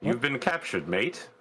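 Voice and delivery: lightly put-on London accent; smug London soldier gloating; fake English accent. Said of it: "full on faking an English accent".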